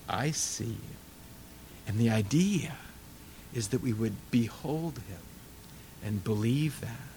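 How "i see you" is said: much softer, gentle and intimate; tender hush. Acted. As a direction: hold their face and say it softly. Lifting your face, tender words.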